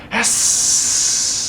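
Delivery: long breathy hiss; monster hiss. A narrator's reading. Ghastly monster rising, hissing. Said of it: "a horrible hissing sound".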